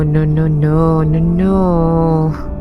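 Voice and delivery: normal talking voice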